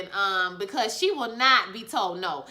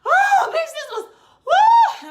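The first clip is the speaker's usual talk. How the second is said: high, loud laughing shriek; shrieking joy; 9 dB louder, slower than usual. Retold or imitated. Retelling the scene to viewers, a loud laughing shriek. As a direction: squeal it out with delight. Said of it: "And she said".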